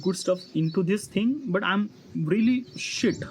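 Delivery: normal talking voice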